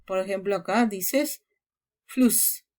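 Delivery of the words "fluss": Fluss said with a short clipped u; short vowel. Shown as a demonstration. Teacher modelling a short vowel in Fluss. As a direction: keep the u in Fluss short before ss. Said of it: "provoca que la vocal anterior sea corta".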